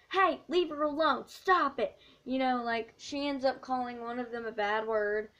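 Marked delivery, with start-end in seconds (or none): 0.1-2.0 high, loud shout to break up a fight; mock scream. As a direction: yell to make them stop. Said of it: "she screams".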